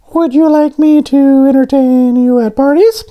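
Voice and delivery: squeaky high cartoon voice; high donut voice. A narrator's reading. Voices Arnie.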